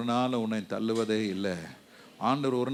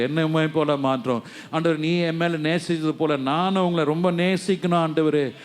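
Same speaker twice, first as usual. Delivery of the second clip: building, louder pleading prayer; fervent prayer; 2 semitones higher, 4 dB louder than usual. Acted. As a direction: plead with rising fervor.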